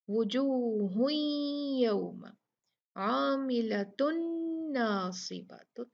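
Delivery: slow recitation merging the tanween into the next letter; Quranic recitation. Shown as a demonstration. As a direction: recite slowly with idgham. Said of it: "तो यहाँ देखें". (धीमी तिलावत, तनवीन को अगले हर्फ़ में मिलाकर)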